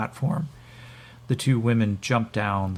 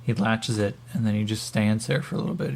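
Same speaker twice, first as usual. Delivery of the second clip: ordinary talk, nothing marked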